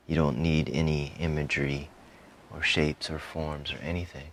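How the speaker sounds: normal talking voice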